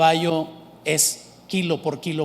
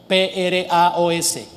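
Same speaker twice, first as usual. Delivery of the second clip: measured letter-by-letter "P R A O S"; teacher slowly spelling "P R A O S"; slower than usual. Shown as a demonstration. Spelling out a Greek word.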